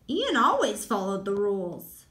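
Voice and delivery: ordinary delivery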